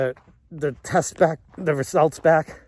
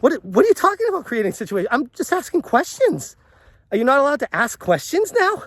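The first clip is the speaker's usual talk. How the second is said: higher and fast, indignant; indignant questioning; 7 semitones higher, faster than usual. Retold or imitated. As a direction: push back, pitched up and rushed. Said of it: "I'm like".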